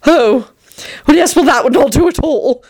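high, flustered demurral; mildly queasy, halting refusal; faint dismay. A narrator's reading. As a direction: object feebly, rattled. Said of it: "Tony said faintly".

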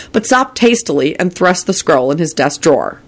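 usual speaking voice

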